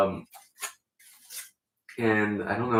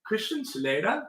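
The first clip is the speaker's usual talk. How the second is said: put-on mid-Atlantic accent; 7 semitones higher than usual. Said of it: "mid-Atlantic accent".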